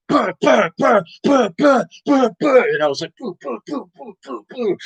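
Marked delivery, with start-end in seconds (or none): 0.1-2.6 high mock punch sounds; mom punching noises. Mother punching him in the face, high mock punch noises. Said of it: "She went". 3.2-4.8 high, soft imitated punches; mock punch noises. Son imitating the punches he took, high soft noises. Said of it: "like that".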